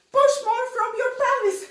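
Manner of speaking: loud, high, fast exhortation; mock over-forced pushing. Dance teacher mimicking those who think harder is better, loud and strained. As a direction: overdo it, forcing out every word at high pitch. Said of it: "like this. Like, push more from".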